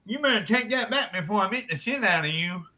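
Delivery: indignant harelip impression; harelip friend. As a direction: threaten in a put-on speech-impediment voice. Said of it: "a friend who's a hair lip".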